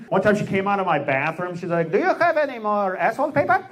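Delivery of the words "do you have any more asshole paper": put-on high, fast Czech accent; Czech accent impression. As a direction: play the Czech girlfriend, puzzled, in her accent. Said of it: "a girl from Czechoslovakia".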